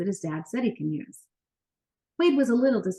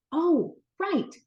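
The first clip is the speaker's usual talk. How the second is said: high, quick oh of remembering; sudden realization; 8 semitones higher than usual. A narrator's reading. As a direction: catch yourself remembering. Wade remembers his job.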